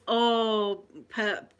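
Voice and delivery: usual speaking voice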